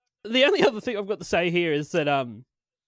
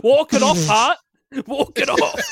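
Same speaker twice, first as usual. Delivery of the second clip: loud, higher coach shout; 7 semitones higher, 6 dB louder than usual. Pretend. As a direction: bark it like a gym coach. Coach voice at heart.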